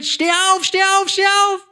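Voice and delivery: high shouted steh auf; German interpreter echoing the screamed wake-up; mock scream. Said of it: "muss ich so schreien".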